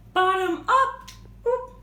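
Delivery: high, bouncy little "oop"; playful exclamation. Shown as a demonstration. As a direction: pop it up playfully. Playful pose demo for children.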